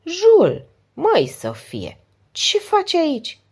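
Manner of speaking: high, loud surprised greeting; a man recognizing an old acquaintance, exclaiming high and loud; delighted exclamation. A narrator's reading. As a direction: greet an old friend with sudden joy. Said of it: "zâmbi brusc și exclamă".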